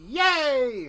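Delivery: high, loud cheer. Acted. Kalani cheering with her hand up, excited. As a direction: shout a gleeful yay. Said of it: "Kalani goes".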